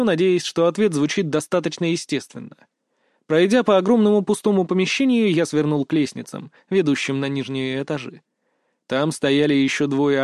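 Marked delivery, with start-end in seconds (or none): none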